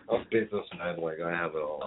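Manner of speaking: low, quiet businessman voice; understated put-on corporate voice. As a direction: drop down into a smooth businessman voice.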